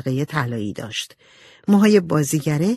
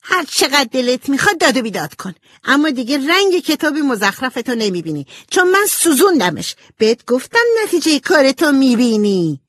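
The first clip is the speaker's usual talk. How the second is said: high, spiteful yelling back; angry shouting; 8 semitones higher, 5 dB louder than usual. A narrator's reading. Amy confessing she burned the book, angry shouting. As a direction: shout back defiantly, pitched right up. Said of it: "ایمی هم عصبانی شد و داد زد".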